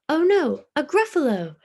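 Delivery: high, loud alarmed cry; fox crying out. Acted. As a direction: shriek it like a startled fox. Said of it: "cried the fox".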